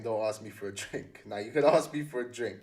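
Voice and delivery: usual speaking voice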